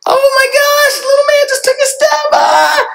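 very high, loud delighted shout; excited shout. Retold or imitated. Parent shouting as little man takes a step, excited shout. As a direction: yell it like the proudest parent alive. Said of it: "You're excited".

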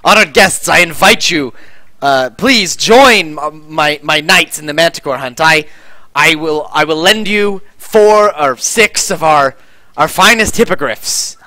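stately commander voice, stretched words; commander's formal invitation. Acted. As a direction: invite honored guests grandly, with halting phrases. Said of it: "He turns and he says".

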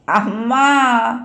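long, drawn-out wail for mother; acted child's bawling call; starting to cry. A narrator's reading. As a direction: wail for Amma, stretching it slowly. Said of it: "అంటూ ఏడుపు ప్రారంభించాడు మళ్ళీ".

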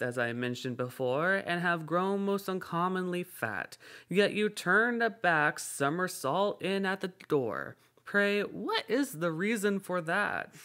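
put-on young man voice. A narrator's reading. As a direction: raise the pitch for the youth.

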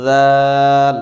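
দাল with the tongue tip at the teeth and the আ stretched; teacher articulating দাল for learners; careful letter articulation. Shown as a demonstration. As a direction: sound দাল slowly from the teeth. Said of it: "জিহ্বার আগা সামনের উপরে দুই দাঁতের আগার সঙ্গে লাগিয়ে".

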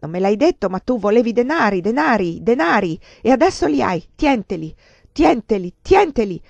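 loud repeated words, each hit hard; enraged outburst. A narrator's reading. Lover striking the countess with banknotes, enraged outburst. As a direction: hurl every repetition at her, building up.

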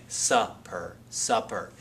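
"supper" said twice with a short, crisp uh; modelling a short u. Shown as a demonstration. A teacher to students, repeating "supper" with a short u. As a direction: clip the first syllable of "supper" short. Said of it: "But listen again".

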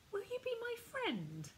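put-on high, timid child's voice; a shy small boy asking; quietly. Ben asks a little boy, high timid child. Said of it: "asked Ben quietly".

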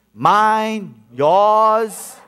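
ordinary delivery

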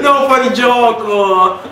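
slow high shout; protesting shout. Real, not acted. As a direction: drag it out, shouting. Protesting the goal.

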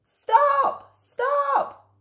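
high desperate shouts; shouting stop. Acted. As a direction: yell after the driver. Boy shouts after the truck.

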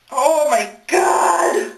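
slow drawn-out cry of itch; anguished itchy cry. Real, not acted. Itch flares up.

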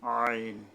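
আইন squeezed out from mid-throat. Shown as a demonstration. Teacher models the Arabic letter, throat-squeezed আইন. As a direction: press the throat to sound a long আইন. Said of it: "গলা চেপে আদায় করতে হবে".

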